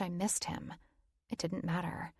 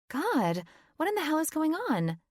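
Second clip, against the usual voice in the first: demanding friend; 10 semitones higher, 5 dB louder than usual. A narrator's reading. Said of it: "she demanded".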